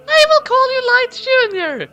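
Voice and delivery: very high, tender falsetto mother. Acted. Exhausted new mother naming her baby, high falsetto. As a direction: coo the name like a new mother.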